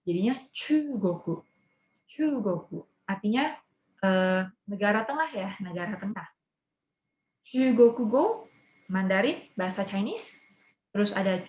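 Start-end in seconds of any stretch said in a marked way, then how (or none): 0.0-2.9 Chugoku said choo-go-koo, slowly; Japanese reading. Shown as a demonstration. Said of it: "cara bacanya sedikit beda". 7.4-8.2 Chugoku go said louder, rising on go; Japanese reading. Shown as a demonstration. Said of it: "cara bacanya sedikit beda".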